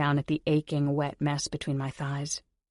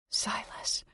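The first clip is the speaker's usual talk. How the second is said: breathy whispered name; 7 dB quieter than usual. Acted. Heroine whispering her lover's name to herself.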